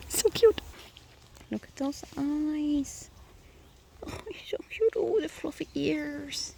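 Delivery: high, soft, drawn-out cooing. Real, not acted. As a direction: gush over something tiny and adorable. Cooing over something cute.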